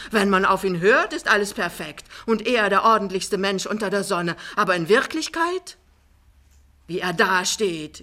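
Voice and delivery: self-excusing mama. Acted. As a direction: shift the blame in a rising tirade. Die Mama schiebt die Schuld ab. (laut und entrüstet die Schuld abschiebend)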